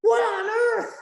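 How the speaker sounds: very high imitated commentator cry; Michael Cole exclamation. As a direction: exclaim it like a stunned ringside announcer. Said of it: "having Michael just come in there".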